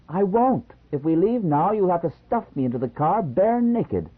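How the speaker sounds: high, loud defiant refusal; petulant tantrum. A narrator's reading. Wife defying husband, loud high tantrum. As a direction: dig in with a shrill no.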